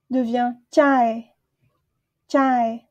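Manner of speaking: "chae" drawn out slowly, falling; careful teacher demo; Khmer word chae. Shown as a demonstration. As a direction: model the word chae for learners. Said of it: "teacher models the word".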